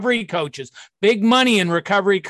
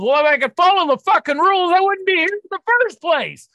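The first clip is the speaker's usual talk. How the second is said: pitched-up, exasperated fed-up retort; rising mock-indignant rant; exasperated outburst; 8 semitones higher than usual. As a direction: hurl it back like a fed-up addict. Patient snaps back at staff.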